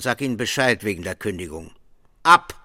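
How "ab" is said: loud barked one-word order; sharp shout. Acted. Boss orders the clerk out, loud bark. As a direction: bark him out of the room.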